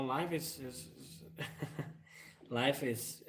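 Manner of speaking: ordinary talk, nothing marked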